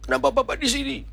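high, puzzled rising question; startled. Retold or imitated. Person waking up startled to see pastor, high question. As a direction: raise the pitch in mild shock. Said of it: "Dia kaget lihat saya".

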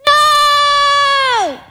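long, high drawn-out cry; desperate cry. A narrator's reading. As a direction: cry out in despair into the darkness. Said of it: "Aladdin cried, his voice echoing".

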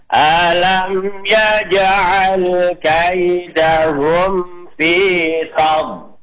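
slow melodic tartil chant; teacher modelling tajwid recitation; Quran recitation. Shown as a demonstration. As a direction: recite it measured and sung. Recites again after count.

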